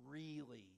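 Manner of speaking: "really" set apart by pauses; stress on REALLY. Shown as a demonstration. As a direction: isolate and stretch the word "really". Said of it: "Get that "really" in there".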